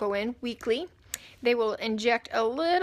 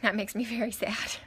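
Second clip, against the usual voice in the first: normal talking voice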